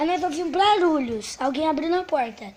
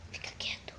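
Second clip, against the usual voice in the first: hushed unvoiced whisper; whispering while hiding; 17 dB quieter, faster than usual. Real, not acted. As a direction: whisper so nobody hears.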